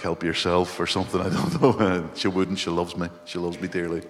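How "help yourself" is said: ordinary talk, nothing marked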